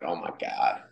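whispered mock dismay; low, breathy whisper under the breath; hushed disbelief. Husband reacting to wife's swamp rat accent, hushed disbelief.

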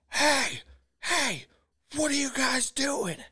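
imitated high-pitched yell from afar; retold frantic yelling. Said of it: "this lady is losing her mind".

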